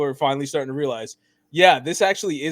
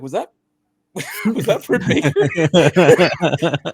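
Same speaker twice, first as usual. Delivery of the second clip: laughing disbelief; 3 semitones higher, 7 dB louder, much slower than usual. Retold or imitated. Recalling a surprise while laughing.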